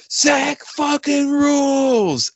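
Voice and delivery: high, slow mock shout across a studio; stretched-out mock yell; imitated yell from the booth. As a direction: call it out like a producer cheering through the glass. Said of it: "Andrew yelled from the fucking control room".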